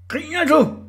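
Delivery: high, loud threatening taunt; taunting shout. Acted. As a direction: yell it up at a rival, high-pitched. Orla taunts narrator in acted quote.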